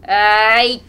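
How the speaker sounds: loud, slow raging bellow; furious shout. A narrator's reading. Mokolka cursing the mare furiously, shouting. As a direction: roar it at the horse. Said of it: "Mokolka shouts furiously".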